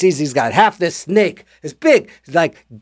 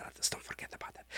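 breathy hushed aside; a quick creepy whisper; eerie whisper; 27 dB quieter, faster than usual. Retold or imitated. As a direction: whisper it like a secret warning. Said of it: "He goes".

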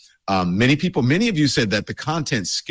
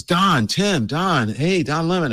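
calling out; 9 semitones higher, slower than usual. Retold or imitated. Man at a coffee shop calling out to Don, retold hailing call. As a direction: hail him like a man spotting a friend. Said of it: "and he goes".

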